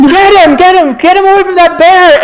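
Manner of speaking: high, loud, urgent orders; frantic cop shout. Acted. Cops ordering others to rescue their partner, frantic high shout. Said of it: "a couple of the cops go".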